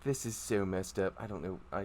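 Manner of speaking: low, quiet grumble to himself; muttering driver. Acted. Driver complains about the job to himself, muttering. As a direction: sink into a soft, weary mutter.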